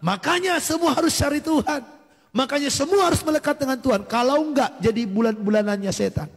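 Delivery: high-pitched urging, re-enacted; fervent exhortation.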